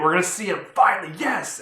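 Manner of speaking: quick, rising burst of anticipation; excited fan cheer. Retold or imitated. Fan reliving the wait for Spider-Man, excited cheer. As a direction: build to a high, thrilled yes.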